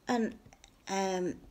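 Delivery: ordinary delivery, nothing marked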